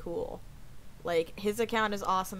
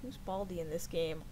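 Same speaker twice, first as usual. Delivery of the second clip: ordinary talk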